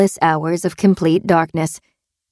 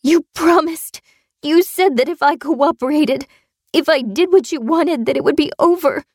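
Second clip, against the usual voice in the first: high, wounded pleading; desperate protest; 9 semitones higher than usual. A narrator's reading. Captive reminds captor of his promise, desperate.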